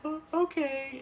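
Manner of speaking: high, stammered mock whimper; small high-pitched sniffly agreement, put on; tearful kid. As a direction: imitate a crying child giving in. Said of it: "freaking out, like, crying super hard".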